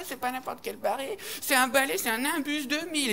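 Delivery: a hint of a spoilt brat; rich kid bragging. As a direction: show off the broom smugly. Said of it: "un gosse de riche".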